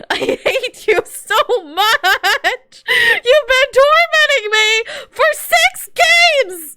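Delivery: high, slow laugh-sob through the words; half laughing, half crying in frustration; cracking up while sobbing it out. Real, not acted. Frustrated rant at game character.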